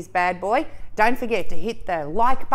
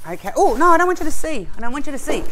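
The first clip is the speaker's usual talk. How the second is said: normal talking voice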